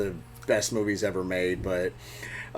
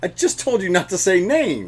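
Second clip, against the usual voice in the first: exasperated scolding; 7 semitones higher, 4 dB louder than usual. Real, not acted. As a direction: scold a friend who blurted it out. Scolds friend for saying a name.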